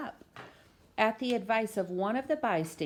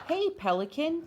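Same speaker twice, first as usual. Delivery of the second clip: higher call to the bird; 10 semitones higher than usual. Acted. Photographer calling out to the bird, high. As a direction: hail the penguin from across the sidewalk. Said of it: "said the photographer".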